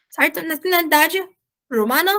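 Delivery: ordinary talk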